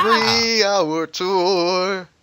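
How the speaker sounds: slow, stretched-out showman announcing; mock announcer. Telling listeners the show is live, hammed-up announcer. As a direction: draw out the big words like a hype man.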